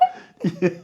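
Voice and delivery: loud high laughter; real laugh. Real, not acted. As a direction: keep laughing. Still laughing.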